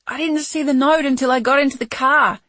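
high panicked scream. Acted. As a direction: scream it in terror. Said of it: "he screamed".

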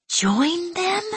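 put-on high shocked boy's cry; horrified. A narrator's reading. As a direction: recoil in horror at the idea. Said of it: "Iska said in horror".

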